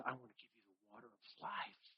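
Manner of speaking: very soft, intimate offer of the water of life; a hushed Jesus offering grace. Retold or imitated. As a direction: drop almost to a murmur, gently. Jesus quoted in a hushed passage.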